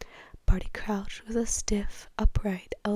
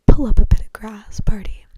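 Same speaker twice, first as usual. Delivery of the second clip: louder, whispery cheerful call; bright invitation; 17 dB louder than usual. A narrator's reading. Ludo inviting Barty to sit on the grass, brightly. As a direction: lift the volume for a breezy invitation. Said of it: "said Ludo brightly".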